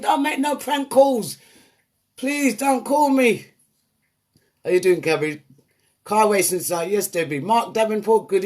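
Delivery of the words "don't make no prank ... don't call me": slow, high pleading; hammed-up begging, drawn out; mock plea. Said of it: "I said".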